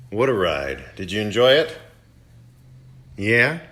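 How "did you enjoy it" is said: usual speaking voice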